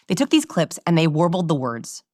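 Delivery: normal talking voice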